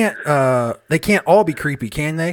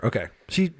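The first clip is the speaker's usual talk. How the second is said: usual speaking voice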